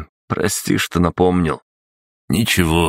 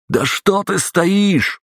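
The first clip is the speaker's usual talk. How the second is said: much higher, slow barking shout; barked shout; much slower than usual. Acted. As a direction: bark it right in his ear. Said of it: "Людвиг рявкнул прямо в ухо". (рявкнуть прямо в ухо)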